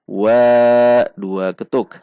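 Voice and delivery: Wa with the a held two beats, slow; two-beat vowel. Shown as a demonstration. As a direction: stretch the a in Wa. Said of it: "mad tabi'i dua ketuk".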